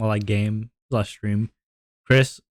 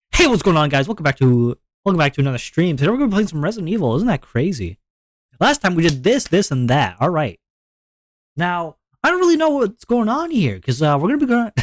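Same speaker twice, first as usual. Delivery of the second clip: hammed-up streamer intro, high swooping pitch; mock enthusiasm; 7 semitones higher than usual. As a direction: gush like an over-eager streamer greeting viewers. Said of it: "way more, like, enthusiasm".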